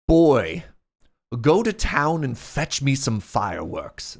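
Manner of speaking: mock-imperious English accent; slow, drawn-out order in a put-on English accent. Wealthy British expatriate ordering a young lodge worker, English accent. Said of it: "That's the best English accent I can do".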